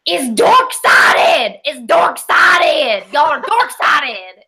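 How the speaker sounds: put-on slow, loud, high Southern accent; Southern accent shout. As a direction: yell it in a heavy Southern accent. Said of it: "heavy Southern accent".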